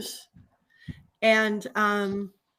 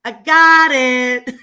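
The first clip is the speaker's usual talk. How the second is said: slow, much louder fed-up "I got it"; exasperated shout; 11 dB louder, much slower than usual. Retold or imitated. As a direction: drag it out loud, done with the lecture. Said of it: "Like, uh".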